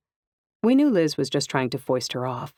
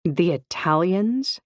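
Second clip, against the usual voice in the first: slow, drawn-out "The Italians?" leaning hard on "Italians" with a rising tune; a teasing, slow "The Italians?" with the weight on "Italians"; with emphasis; 5 semitones higher, slower than usual. A narrator's reading. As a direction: stretch "Italians" out knowingly and let it rise. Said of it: "then added with emphasis".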